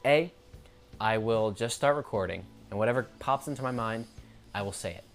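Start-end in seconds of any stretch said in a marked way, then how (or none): none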